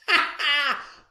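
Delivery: a high mocking laugh; lion laughs. The lion laughing at the man's statue, high mocking laugh. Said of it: "The lion laughed".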